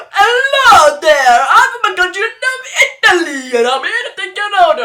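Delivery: hammed-up foreign waiter greeting, high and loud; mock Italian accent. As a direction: put on a silly Italian accent. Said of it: "You're like".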